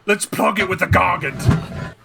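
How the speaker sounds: hammed-up Warhammer orc grunt. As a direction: bellow it like a dim, eager orc to his boss. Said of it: "An orc would just be like".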